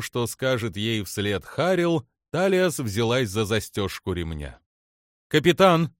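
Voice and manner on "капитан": raised, higher-pitched alert; crying out. A narrator's reading. Crew member warns the captain of approaching ships, crying out. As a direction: call out an alarm to the captain. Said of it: "выкрикнула Далву".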